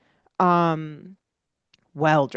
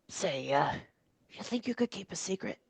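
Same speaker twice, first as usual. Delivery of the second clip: put-on hushed old man; 12 dB quieter, faster than usual. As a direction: lean in and whisper conspiratorially. Old man whispers to player.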